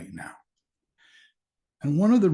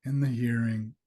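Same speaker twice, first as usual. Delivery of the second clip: guided meditation; 3 dB quieter than usual. Acted. Teacher guiding listeners to the sounds around them, calm meditation voice. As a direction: ease listeners gently into stillness, leaving space between phrases.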